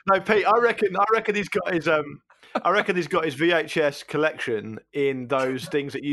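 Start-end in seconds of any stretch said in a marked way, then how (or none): none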